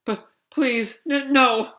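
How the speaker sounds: high, stammering begging; frightened plea. Acted. Pino begging as her leg is threatened, frightened stammer. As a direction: plead in small halting bursts.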